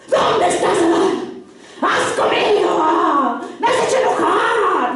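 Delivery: mock-furious, drawn-out bellow; hammed-up scolding with stretched words; angry God. Preacher parodying how people imagine God scolding Adam, mock-angry shout. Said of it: "nosotros le hemos puesto el tono".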